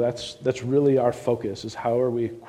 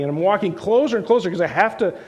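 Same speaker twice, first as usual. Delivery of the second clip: rising, louder push toward the door; building excitement; 5 semitones higher, 3 dB louder than usual. Retold or imitated. Preacher retells the convert walking to the door, building excitement. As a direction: build the tension step by step toward the bright light. Climax of the night visit.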